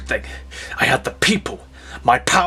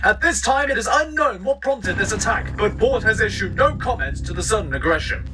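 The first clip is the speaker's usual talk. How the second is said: higher, fast urgent anchor; breaking news read; 8 semitones higher than usual. Acted. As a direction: announce the attack on air.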